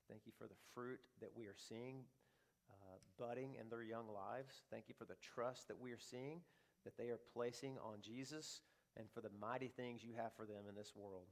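put-on soft, earnest prayer over the kids. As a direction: pray quietly and sincerely. Pastor prays over the children.

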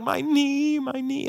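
high whimpering child's voice, lightly mocked; imitating crying child. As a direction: echo a little boy crying over his knee. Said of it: "and he's just crying".

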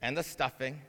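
lightly hammed-up, hushed formal manners; mock solemn. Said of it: "this solemn ceremony where it's gonna be like".

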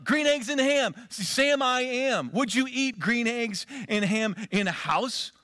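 Dr Seuss reading. Pretend. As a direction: bounce it like a children's rhyme book. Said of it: "Doctor Seussian feel".

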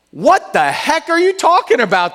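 high incredulous blurt; baffled paralytic. Retold or imitated. Pastor voicing the paralytic's confused thought, incredulous. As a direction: sound completely baffled. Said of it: "the paralytic must have thought".

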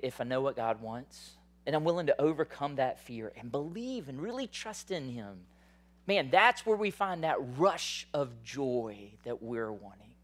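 usual speaking voice

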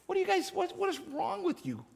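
high, fast mock-indignant voice; mocking high huffy protest; indignant disciples. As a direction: squeak out the outrage like a petty whiner. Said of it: "they become indignant".

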